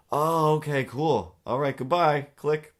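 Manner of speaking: high, loud breezy goodbye; cheery caller. Retold or imitated. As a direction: brush it off cheerily and hang up. Voicing the caller hanging up.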